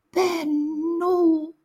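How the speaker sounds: tiny high piping voice calling Benno; squeaky toddler. Acted. Little Lisa calling out to her brother on the roof, piping high. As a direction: reach out and squeak his name like a baby. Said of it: "piepste Lisa".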